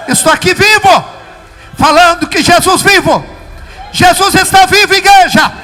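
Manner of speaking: loud, high preacher's proclamation; full-force preaching. Real, not acted. Preacher proclaiming to the church that Jesus lives, full-force shouting. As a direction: shout it out to the whole church in triumph.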